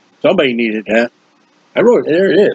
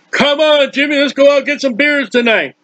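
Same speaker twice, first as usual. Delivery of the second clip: higher, coaxing buddy voice; tempting friend; 6 semitones higher than usual. Acted. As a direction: lure Jimmy out like a pal.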